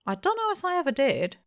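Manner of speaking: put-on high, quick old-man whine; feeble denial. A narrator's reading. Hempseed denying to the innkeeper, high and feeble. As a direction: deny it weakly and fast.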